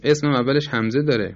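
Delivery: normal talking voice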